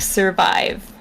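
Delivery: ordinary delivery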